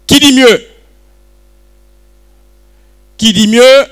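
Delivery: loud call to the room; calling out bids. Real, not acted.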